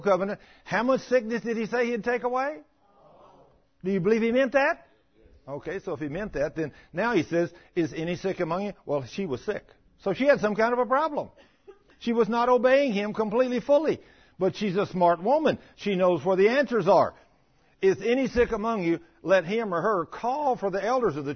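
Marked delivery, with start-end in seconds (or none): none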